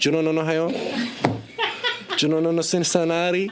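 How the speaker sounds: high hammed-up Belgian-Cuban accent, laughing; mock Belgian-Cuban accent. Son recalling the car game, mock Belgian-Cuban accent. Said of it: "We did that for, like, ten minutes".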